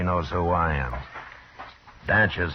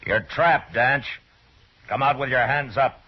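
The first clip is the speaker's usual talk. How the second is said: higher, firm call to the men inside; 7 semitones higher, 4 dB louder than usual. Acted. Marshal calling to Danch inside the hut, firm raised call. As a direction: order him out through the door.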